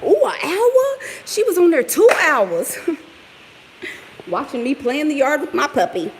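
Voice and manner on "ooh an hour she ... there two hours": high, slow mocking whine; mocking critics. Vlogger mimics critics complaining about her long video, high and mocking. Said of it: "They gonna be like".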